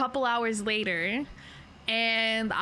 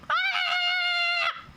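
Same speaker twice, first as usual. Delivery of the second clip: short, sharp high yelp; sudden shout. Shown as a demonstration. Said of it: "Did you hear that?".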